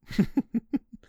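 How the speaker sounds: bright, high bashful giggle. Acted. Giggle after bold request.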